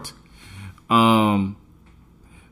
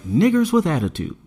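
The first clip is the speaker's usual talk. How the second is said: mocking newscasters; 6 semitones higher than usual. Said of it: "and they're like".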